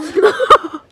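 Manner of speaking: high, loud burst of real laughter; helpless loud cackle. Real, not acted. Cracks up at own story.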